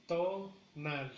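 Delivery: "Tonal" split as TO, nal; slow teaching model with a gap after TO; syllable by syllable. Shown as a demonstration. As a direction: separate the two beats of "Tonal". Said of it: "en la primera sílaba".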